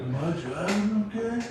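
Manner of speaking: lightly voiced dazed stoner; stoner impression. Stoner driver asking his buddy, dazed voice. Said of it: "[name] and [name] Up in Smoke".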